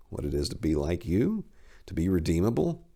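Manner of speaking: plain reading voice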